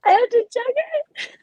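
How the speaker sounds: can't believe she has to chug it; surprised question. Real, not acted.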